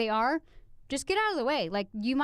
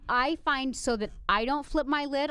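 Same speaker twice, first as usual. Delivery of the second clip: ordinary delivery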